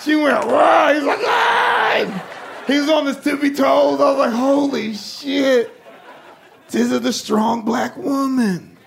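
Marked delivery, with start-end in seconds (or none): 0.5-0.9 loud sharp roar; mock battle cry. Mom tackles dad. 1.2-2.6 squeaky surprised yelp; high yelp. Retold or imitated. Dad cries out in headlock. 4.3-8.8 slow, amazed, laughing; awed. Retold or imitated. Awed at mom winning.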